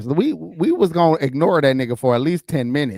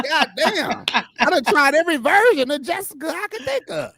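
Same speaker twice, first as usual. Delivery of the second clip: frustrated high voice; 12 semitones higher than usual. Retold or imitated. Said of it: "They like".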